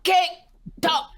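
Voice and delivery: high, broken-up words like a dropping call; mock cutting-out phone voice; fake bad connection. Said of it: "You just go like this".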